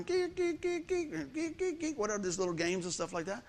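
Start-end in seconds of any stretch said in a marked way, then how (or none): 0.0-2.0 high mock chirping like a phone game bird; imitating a phone notification chirp. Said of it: "the little bird going".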